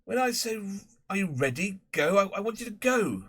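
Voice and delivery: a harassed master trying to start a race; fussy schoolmaster. Acted. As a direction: Prendergast instructing the boys before the race. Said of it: "Mister Prendergast could be heard saying".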